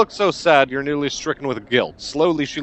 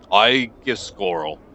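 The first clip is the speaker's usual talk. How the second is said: slow, evenly ticking delivery; flat, measured merchant; 3 semitones lower, slower than usual. Acted. Trembling cloth merchant introduces himself to a customer, flat and measured. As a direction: space the words out like a slow clock. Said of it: "voice ticks like a clock, flat and measured".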